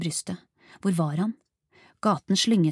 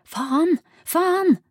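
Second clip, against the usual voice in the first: high, loud cry of frustration; frustrated yelling; screamed curse; 11 semitones higher, 6 dB louder than usual. A narrator's reading. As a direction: scream the swear word, rising on the repeat. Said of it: "skrek hun".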